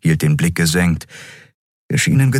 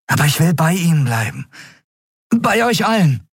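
high, pleading eagerness; eager young crewmate; 12 semitones higher than usual. A narrator's reading. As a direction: beg the captain to let you come along. (eifrig bittend, mit hoher Stimme)

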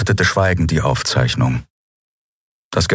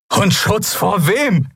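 high, slow, anguished question; voice breaking; slower than usual. A narrator's reading. Bearded man challenges the captain, voice breaking. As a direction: cry it out at breaking point. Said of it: "Seine Stimme brach".

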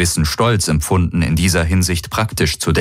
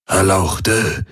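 slow, aged murmur; murmuring old man. A narrator's reading. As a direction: murmur it like an elderly, deferential attendant. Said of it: "murmelte der greise Aur".

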